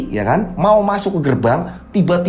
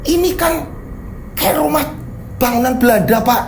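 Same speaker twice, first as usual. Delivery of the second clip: high-pitched, confused questioning; alarmed bewilderment; 7 semitones higher than usual. Acted. Driver seeing the house turned derelict, alarmed bewilderment. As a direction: ask in rising panic, pitched well up.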